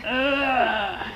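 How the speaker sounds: high mock groan while faking a stretch; a hammed-up stretching ugh; mock stretch grunt. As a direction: grunt it like a guy sneaking the arm around.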